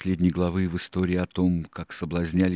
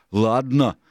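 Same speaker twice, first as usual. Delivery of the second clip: loud, raised ringleader's tirade; higher, louder pirate boss holding forth; Silver, forceful and impatient; 5 semitones higher, 7 dB louder than usual. A narrator's reading. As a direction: lay out the scheme forcefully. Silver rebukes the crew.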